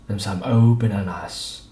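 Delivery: usual speaking voice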